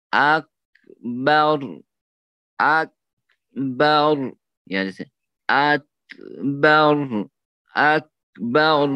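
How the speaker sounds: اکبر stretched very slowly; slow drawn-out demo. Shown as a demonstration. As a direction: draw out each اکبر slowly. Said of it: "جب دھیرے سے پڑھے گا".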